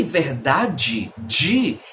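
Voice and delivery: verdade de with DJEE endings, drawn slowly; one Brazilian accent. Shown as a demonstration. Teacher isolating one accent's sound, verdade de said with DJEE. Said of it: "é apenas um dos sotaques".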